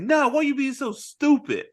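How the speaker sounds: high-pitched mock exasperation; exasperated retort. Mike snapping back at calm Marcus, mock exasperation. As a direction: imitate a pushy friend, voice jumping up.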